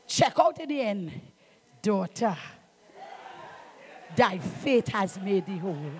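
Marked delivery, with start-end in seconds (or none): 1.8-5.9 Jesus speaking. Retold or imitated.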